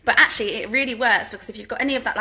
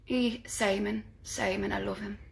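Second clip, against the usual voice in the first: soft Geordie accent impression; 7 dB quieter than usual. Presenter showing off her impression, soft Geordie accent. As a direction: quietly put on a Geordie lilt. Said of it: "do a [name] impression".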